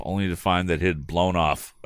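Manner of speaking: ordinary delivery, nothing marked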